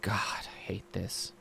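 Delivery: slow sullen whisper; whispered complaint. Acted. Drow teen whispering her complaint. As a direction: hiss it to yourself. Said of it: "She's like".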